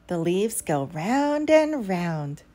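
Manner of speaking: plain reading voice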